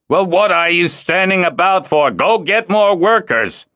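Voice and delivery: shouting. A narrator's reading. Dagan ordering Larat away, shouting impatiently. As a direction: bark the order across the camp.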